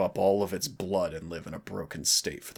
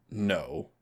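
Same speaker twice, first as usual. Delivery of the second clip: ordinary talk, nothing marked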